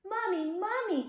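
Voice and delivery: put-on high, loud child's cry of Mommy; small child calling for mom; children crying out. A narrator's reading. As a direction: call out like a scared little kid.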